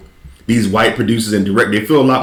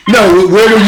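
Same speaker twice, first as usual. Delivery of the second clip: louder, higher grilling; mock interrogation; 9 semitones higher, 5 dB louder than usual. Questioning a fake Brit's origins, in a loud pushy voice. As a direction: press him like a suspicious host.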